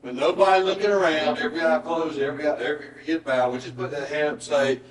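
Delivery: altar call preaching. Acted. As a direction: plead with the congregation to respond. Pastor leads an altar call.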